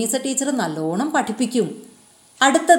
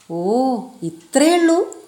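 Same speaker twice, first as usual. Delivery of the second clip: put-on a boy's bright little realisation; surprised relief; 4 semitones higher than usual. A narrator's reading. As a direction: sound like it's easier than feared. Character's relieved exclamation.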